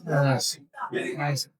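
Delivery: ordinary talk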